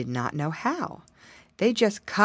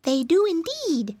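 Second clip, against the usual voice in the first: little pig voice; 11 semitones higher than usual. Acted. Pig character in story.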